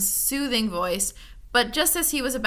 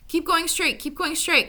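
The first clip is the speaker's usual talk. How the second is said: high, sing-song advice; distant voice giving tricky advice; counseling voice; 8 semitones higher than usual. A narrator's reading. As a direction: coax them along. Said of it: "counseled the voice".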